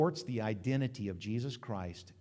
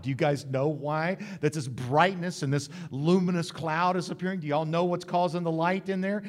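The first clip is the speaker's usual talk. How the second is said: ordinary talk, nothing marked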